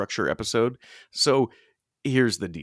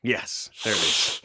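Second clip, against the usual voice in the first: ordinary delivery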